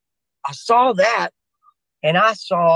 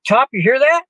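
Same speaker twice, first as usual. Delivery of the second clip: raised, higher call across the dark; 9 semitones higher, 3 dB louder than usual. Retold or imitated. Soldier calling to his sergeant across the field. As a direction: call out to get his attention.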